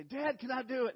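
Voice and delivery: put-on high, loud begging child; pleading kid voice. Boy begging his dad to let him jump, high and loud. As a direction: beg Dad like an eager boy.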